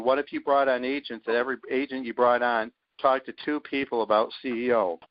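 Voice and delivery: ordinary talk, nothing marked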